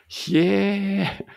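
long, high, loud gasp of astonishment; amazed exclamation. Real, not acted. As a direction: draw it out high in real surprise. Amazed at an unknown word's reading.

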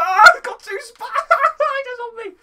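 high, loud laughing panic; helpless laughter. Real, not acted. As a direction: laugh it out in alarm. Laughing at spiders on him.

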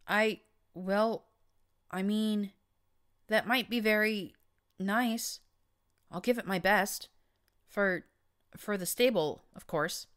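tripping over shy delight; blushing. A narrator's reading. Mare flattered by the Overmare, flustered blushing stammer. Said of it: "I could practically hear her blushing".

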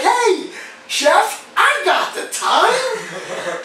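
high voice, slowly drawn-out words; playful calling out. Retold or imitated. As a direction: sing out the joke to the chef. Acts out his joke to the chef.

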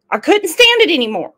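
exasperated. Retold or imitated. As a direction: blurt it out, fed up. Repeated louder and higher.

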